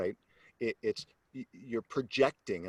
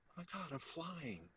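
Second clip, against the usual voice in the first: very soft, higher voice; hushed awe; 6 semitones higher, 19 dB quieter than usual. Retold or imitated. Jumper suddenly finding herself flying, hushed awe. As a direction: drop to a tiny amazed hush.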